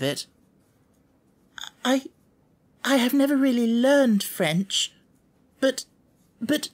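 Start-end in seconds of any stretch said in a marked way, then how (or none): 1.8-6.7 put-on higher, hesitant child voice; Sarah trying shyly to correct her teacher; shy and halting. A narrator's reading. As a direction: stumble shyly trying to explain. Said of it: "trying shyly to make herself clear".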